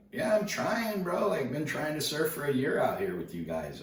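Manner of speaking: high-pitched, pleading comeback; mock-exasperated answer to a bro; retold protest. Answering a heckling local surfer.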